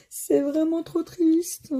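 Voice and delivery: high, slow mock whimper; hammed-up sad moan.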